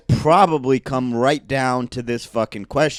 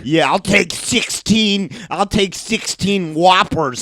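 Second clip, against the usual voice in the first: high, loud, slow order-shouting; American Rick; 4 semitones higher, 4 dB louder than usual. Acted. Host playing American Rick ordering burgers, loud and slow. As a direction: bark the order like a greedy loudmouth. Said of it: "I'm American Rick".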